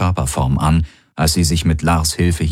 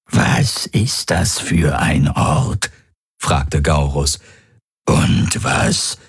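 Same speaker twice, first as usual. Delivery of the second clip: creature voice; 3 semitones lower, much slower than usual. A narrator's reading. Creature Gaurus speaks.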